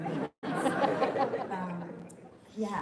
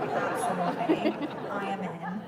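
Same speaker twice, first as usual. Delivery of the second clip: eager acceptance; 6 dB louder than usual. Retold or imitated. Retells eagerly accepting the role.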